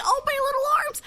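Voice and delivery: high, squeaky strained little voice; a weakling who can't lift heavy pans, whining high; whiny weakling. Pretend. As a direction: whine about his tiny weak arms. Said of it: "He's like".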